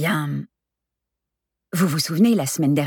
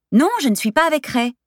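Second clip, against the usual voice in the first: exasperated denial with pitch leaping up; protesting denial; 10 semitones higher, 4 dB louder than usual. Acted. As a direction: brush off the accusation emphatically. Lola protests on the phone.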